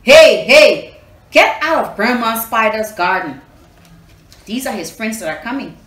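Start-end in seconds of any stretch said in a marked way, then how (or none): 0.0-3.4 loud shouting; shouts. Acted. Friends shouting from the garden, very loud. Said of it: "he heard shouts".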